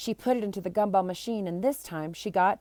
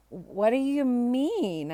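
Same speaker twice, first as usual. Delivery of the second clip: put-on puzzled boy; 4 semitones higher, much slower than usual. Barry asking, voiced figure.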